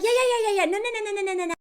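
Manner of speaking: high rushed yeahs, then a whimpering no; mock panicky denial. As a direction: ham up a flustered man backpedalling. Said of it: "But he's like".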